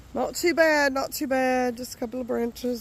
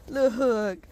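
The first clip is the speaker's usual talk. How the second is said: ordinary talk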